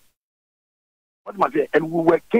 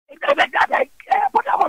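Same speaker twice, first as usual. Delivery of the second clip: much higher, squeaky mock cries; mock squeal. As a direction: squeal it like a mocked woman. Mocking squeal inside retold story.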